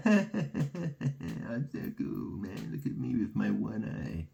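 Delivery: mocking a Freemason. Mocking Freemasons to the viewers, laughing swagger. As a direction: sneer at the cool one-eyed Freemason. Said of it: "be like".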